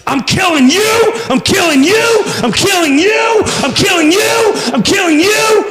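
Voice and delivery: high, frantic repeated yell; hammed-up killing rampage; enraged shouting. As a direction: yell it over and over, each last word rising high. Acted vengeful man in analogy.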